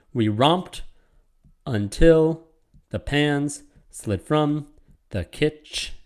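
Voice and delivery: slow metrical reading in separate beats, stopping short at "kitch"; measured verse, "until" set apart, "kitch" broken off; split-word scansion. Shown as a demonstration. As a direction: read beat by beat with pauses, cutting off "kitch". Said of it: "word is split up".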